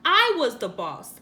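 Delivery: ordinary delivery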